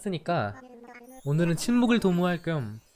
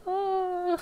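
high whimper. Real, not acted. Overwhelmed by cuteness.

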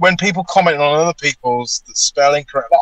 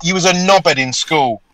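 mock rant; 5 semitones higher, 3 dB louder than usual. Ranting at bad spellers.

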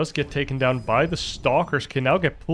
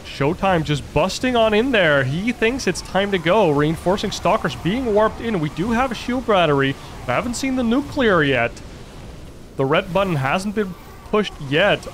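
excited play-by-play; 5 semitones higher than usual. Real, not acted. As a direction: call the push with rising excitement. Big fight breaks out.

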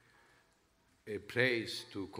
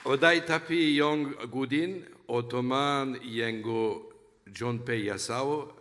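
louder, deliberate phrases in newly learned Cantonese; careful learner's Cantonese; 2 semitones higher, 4 dB louder than usual. Real, not acted. Musician greeting the Hong Kong audience in Cantonese, loud and careful. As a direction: sound out each foreign phrase loud and carefully. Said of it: "please forgive me for my accent".

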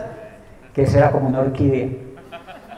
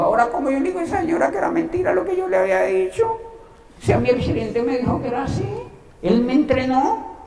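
worried inner voice; 12 semitones higher than usual. Retold or imitated. Retold inner thought, worried.

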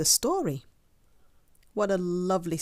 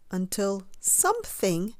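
usual speaking voice

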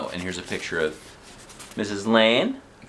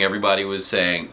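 plain reading voice

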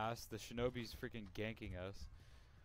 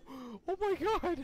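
very high, slow laughing outburst; 9 dB louder, slower than usual. Real, not acted. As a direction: laugh in shocked disbelief. Laughing in disbelief.

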